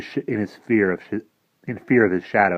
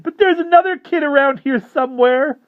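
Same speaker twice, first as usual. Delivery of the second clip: frantic pleading; 8 dB louder than usual. Acted. Boy pleads with vampire, high frantic whine. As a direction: bargain desperately for your life. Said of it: "a new round of supplications".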